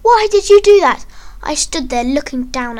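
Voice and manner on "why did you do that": high, loud accusing shout. Acted. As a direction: shout it up high at the one who hurt you.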